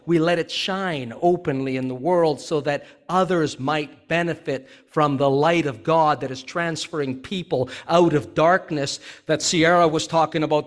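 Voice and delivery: ordinary talk, nothing marked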